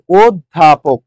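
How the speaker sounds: "অধ্যাপক" drawn out slowly in separate syllables; syllable-by-syllable pronunciation. Shown as a demonstration. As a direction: sound out "অধ্যাপক" slowly, part by part. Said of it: "অধ্যাপককে কি বলবো?". ("অধ্যাপক" ধীরে টেনে ভেঙে ভেঙে উচ্চারণ)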